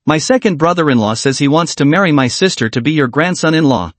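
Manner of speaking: ordinary delivery, nothing marked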